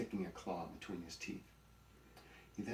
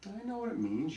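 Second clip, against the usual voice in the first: high, loud comeback; irritated retort; 12 semitones higher, 7 dB louder than usual. A narrator's reading. Donkey retorting to the buzzard, high and annoyed. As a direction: bristle at being talked down to.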